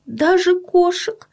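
high, slow voice of a worried mother; tearful mother. A narrator's reading. Mother admitting her son fears even cats, tearful. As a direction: confess it as a sad mother, high-pitched. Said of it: "тихо сказала мама и всхлипнула".

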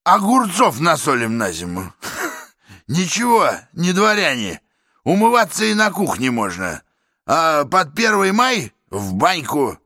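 a swaggering boor, self-satisfied; brash working man. Acted. Brash man in cap inspects flat.

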